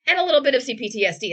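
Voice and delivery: fast, loud deadpan sarcasm; sarcastic. Acted. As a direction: rattle it off sarcastically. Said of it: "we are being very sarcastic right now".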